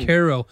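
Cairo said KAIR-oh, falling at the end; local pronunciation. Shown as a demonstration. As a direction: firmly say Cairo with "care" up front. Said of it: "It's Cairo".

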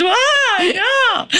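a very high, delighted squeal cracking into a laughing cry; excited shriek. Retold or imitated. Telling the host how she reacted to learning she was pregnant, very high delighted squeal. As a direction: shriek with joy and disbelief, half laughing.

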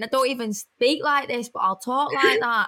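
exaggerated Manchester accent; broader Manc accent. Shown as a demonstration. Showing how they talk in America, stronger Manc accent. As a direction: lay the Manc accent on thicker. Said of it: "I always go more Manc".

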